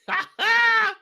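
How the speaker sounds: a shrill, giddy burst of laughter; high excited giggle; high squealing laugh. Real, not acted. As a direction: cackle way up high. Laughs before hype call.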